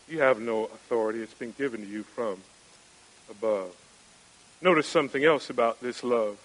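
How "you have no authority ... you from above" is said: low and much softer, almost dropping away; hushed reply. Acted. Preacher repeating Jesus to Pilate, hushed and low. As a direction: lean in and let it fade on above.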